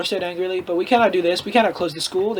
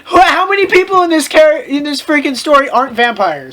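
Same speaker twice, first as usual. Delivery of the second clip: higher and louder, exasperated; 6 semitones higher, 3 dB louder than usual. Real, not acted. Reader complaining about the vampire count, exasperated. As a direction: throw up your hands at it.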